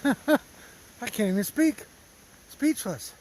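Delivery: barely getting words out, overcome; choked up. Real, not acted. Hiker overwhelmed by trail magic kindness, choked up and teary. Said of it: "Feel like I'm gonna start crying".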